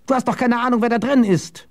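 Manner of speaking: high, urgent call after someone; alarmed warning. Acted. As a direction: call him back in alarm. Jasper calls after fleeing Goddard.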